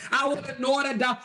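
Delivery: ordinary talk, nothing marked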